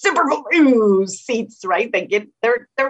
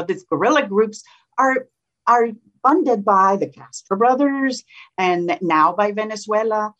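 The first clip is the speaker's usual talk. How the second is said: ordinary talk, nothing marked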